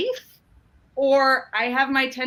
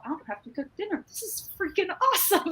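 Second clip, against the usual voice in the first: gleeful; 6 semitones higher, 5 dB quieter, faster than usual. Retold or imitated. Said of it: "I go".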